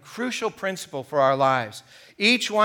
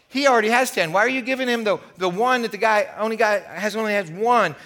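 indignant protest; 5 semitones higher, 4 dB louder than usual. Acted. Servants protesting as unfair.

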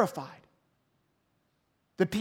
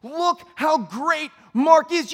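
slow mock praise; 6 semitones higher, 6 dB louder, much slower than usual. Imagined praise from crowd.

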